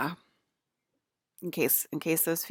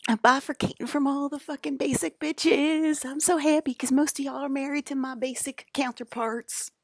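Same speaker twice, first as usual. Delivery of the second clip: giddy gloat; 10 semitones higher than usual. Real, not acted.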